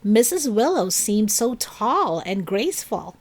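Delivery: ordinary delivery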